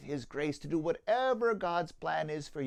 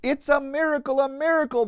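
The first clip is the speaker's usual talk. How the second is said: high, louder cry of wonder; 10 semitones higher, 12 dB louder, faster than usual. Acted. Donkey cries out as his body grows strong, excited and high. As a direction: exclaim it, astonished by new strength. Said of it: "the donkey cried out".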